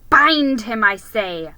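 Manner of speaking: loud, commanding witch. Acted. Witch ordering the hags, loud and cold. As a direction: order them coldly to bind him.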